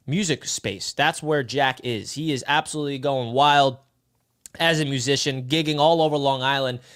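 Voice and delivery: ordinary talk, nothing marked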